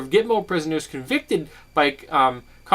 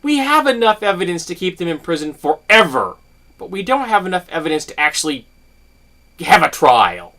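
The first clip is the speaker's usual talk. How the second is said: mocking officials; 3 semitones higher, 6 dB louder than usual. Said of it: "You don't get to say".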